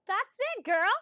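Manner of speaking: high, loud whoop of approval; thrilled, high-pitched shout; excited cheer. Real, not acted. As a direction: cheer on a friend loudly. Cheering Tammy's news.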